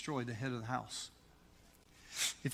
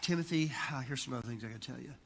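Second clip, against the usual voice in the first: quick, low, sighing throwaway delivery; casual, offhand; 3 semitones lower, faster than usual. Retold or imitated. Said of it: "He's not just saying".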